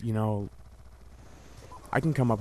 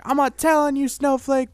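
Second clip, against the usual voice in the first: mocking bully retort; 13 semitones higher, 5 dB louder than usual. Bully talking back.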